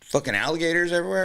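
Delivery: fast, high imitation of a worried guest; mock alarm. Imitates what people keep asking.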